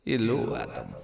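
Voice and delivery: low menacing vampire greeting; Dracula voice. Acted. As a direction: purr it like a count. Dracula enters.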